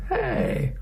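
high, indignant "Hey"; protest, squeaky high. Acted. Daughter protests.

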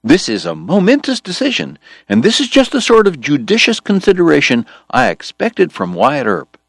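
high, bright, flattering tone; enthusiastic praise. Acted. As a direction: lay the flattery on thick. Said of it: "George cried".

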